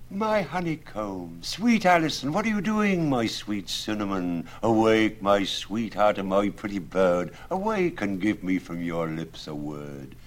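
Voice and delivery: high, sugary wooing suitor; lovesick suitor voice. Acted. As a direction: coo up at her window. Absalom wooing under window.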